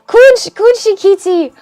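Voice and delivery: sudden loud, high cry of recognition; excited outburst. Real, not acted. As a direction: burst out as the name hits you.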